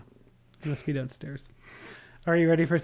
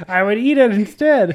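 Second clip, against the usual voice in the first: laughing; 7 semitones higher, 11 dB louder than usual. Real, not acted. Laughing while joking.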